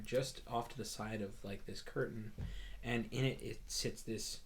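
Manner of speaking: ordinary talk, nothing marked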